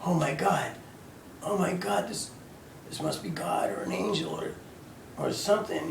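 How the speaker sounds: hushed wonder at a glowing figure; marvelling, half-whispered oh my God; awed hush. Retold or imitated. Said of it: "and I'm going".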